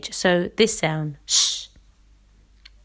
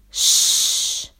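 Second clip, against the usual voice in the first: slow, unvoiced sh pushed out on all the air; one steady shushing hiss; long sh hiss. Shown as a demonstration. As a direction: let the air stream out as a long sh. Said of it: "The first one will be".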